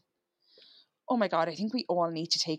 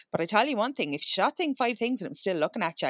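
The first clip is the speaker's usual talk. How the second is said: normal talking voice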